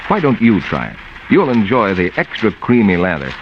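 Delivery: ordinary talk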